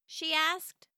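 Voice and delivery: plain reading voice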